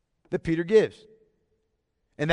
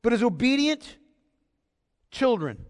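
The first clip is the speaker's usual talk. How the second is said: obedient raised high and held; stress on obedient; 4 semitones higher than usual. Shown as a demonstration. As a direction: lift obedient, drop children. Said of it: "The emphasis is on".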